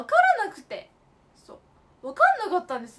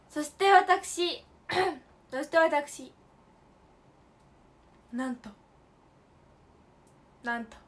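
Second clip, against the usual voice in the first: stretched, slow announcing; slow dramatic build-up; slower than usual. Real, not acted. Teasing viewers before big news, slowly. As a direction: drag out the words for suspense.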